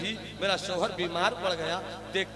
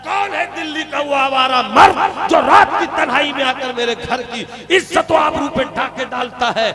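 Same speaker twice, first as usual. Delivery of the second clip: high, loud enraged shout; furious shout; 7 semitones higher, 11 dB louder than usual. Acted. Bedridden husband accuses his wife, furious shout. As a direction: roar it out in jealous fury. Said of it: "काफी गुस्से में".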